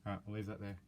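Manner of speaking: ordinary delivery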